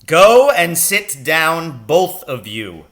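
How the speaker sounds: loud, slow, high command; barked order. A narrator's reading. Captain Lancaster orders both boys back to their desks, loud and slow. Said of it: "Captain Lancaster ordered".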